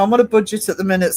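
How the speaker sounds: ordinary talk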